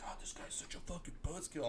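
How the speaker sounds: whispered grumble, imitating someone; muttered aside. Imitating the beer guy complaining, whispered grumble. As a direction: mutter it under your breath, barely voiced.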